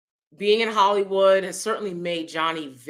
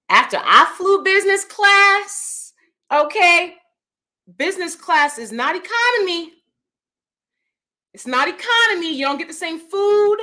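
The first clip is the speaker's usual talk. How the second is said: giddy business-class rave; 12 semitones higher, 7 dB louder than usual. Acted. Gushing about flying business class.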